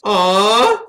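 drawn-out, loud coo of delight; loud "aww". Real, not acted. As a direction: gush at something adorable. Melting at a cute scene.

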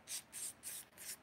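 sharp unvoiced tss-tss like correcting a dog; quick breathy shushing clicks at a dog; imitated hiss. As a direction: mimic the Dog Whisperer's correction noise. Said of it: "he go".

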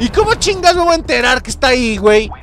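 very high, loud shocked protest; high indignant outcry; outraged disbelief. Real, not acted. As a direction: shriek in disbelief at the game. Outraged after sudden death.

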